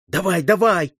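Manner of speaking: high, eager exclamation; delighted eagerness. A narrator's reading. Boy offered a rifle, delighted cry. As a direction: jump at the chance. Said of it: "обрадовался сын казнённого".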